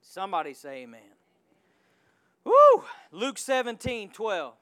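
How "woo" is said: high loud whoop; high loud church woo; joyful whoop. Real, not acted. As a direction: let out a celebrating shout. Preacher shout.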